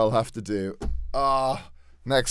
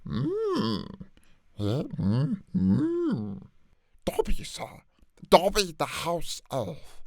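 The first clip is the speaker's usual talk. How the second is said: trial Dobby voice; 3 semitones higher, 3 dB quieter than usual. Acted. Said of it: "It sounds a little too more like".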